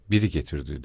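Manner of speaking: low, mildly reluctant answer; understated reluctance. A narrator's reading. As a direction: give it up unwillingly, kept low.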